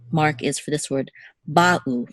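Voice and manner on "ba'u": ba'u with the accent on ba; stress on first syllable. Shown as a demonstration. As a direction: hit "ba" in ba'u, let u drop. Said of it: "this is where the accent".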